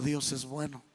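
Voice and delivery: soft, low, steady answer; calm servant. Acted. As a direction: answer calmly, without fear. Calm servant answers angry king.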